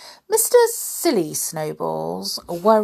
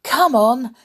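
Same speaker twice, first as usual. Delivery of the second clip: louder eager urging; eager call; 7 semitones higher, 7 dB louder than usual. Acted. Mister Nonsense urging tobogganing, eager. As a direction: rouse him to come. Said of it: "said Mister Nonsense".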